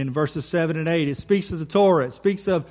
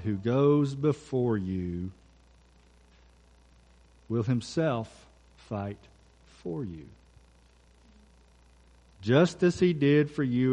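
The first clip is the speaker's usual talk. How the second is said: slow, hushed reading of the verse, word by word; solemn scripture reading; 5 semitones lower, 4 dB quieter, slower than usual. Acted. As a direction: lay each promise down gently and slowly.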